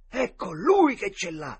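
high, loud, slow cry; awed exclamation. A narrator's reading. Boys see whom the dog is facing, shocked exclamation. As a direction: cry out in sudden dreadful realisation. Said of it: "esclamarono all'unisono i due ragazzi".

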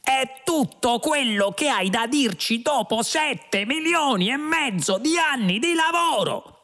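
high-pitched, slow shrieking protest; outraged yell. A narrator's reading. Lunqual rages at the computer's poor answer, yelling. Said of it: "urlò Lunqual".

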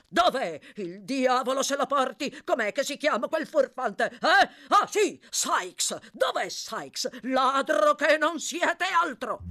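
high, loud rant building to a drawn-out insult; furious demand. Acted. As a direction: rage at the prisoner, hurling accusations. Doctor furiously demands the culprit. (sfuriata gridata)

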